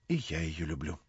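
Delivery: said quietly. A narrator's reading. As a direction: barely voice it, as a shy admission. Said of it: "прибавила она тихо".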